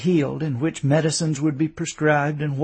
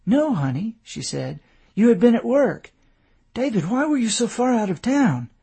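wife's voice; 7 semitones higher than usual. A narrator's reading. Puzzled wife.

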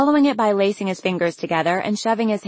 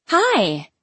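high, happy greeting; pitched-up joyful hail; happy cry; 8 semitones higher than usual. A narrator's reading. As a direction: cry out a delighted hello. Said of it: "she cried happily".

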